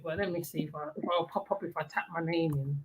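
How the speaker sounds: normal talking voice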